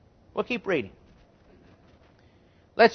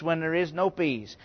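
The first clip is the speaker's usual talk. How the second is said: ordinary delivery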